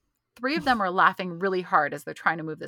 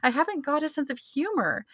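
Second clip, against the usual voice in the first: high-pitched, hammed-up tearful lament; mock crying; 7 semitones higher, 3 dB louder than usual. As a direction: imitate a tipsy sitcom character's weepy confession. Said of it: "she's crying and she goes".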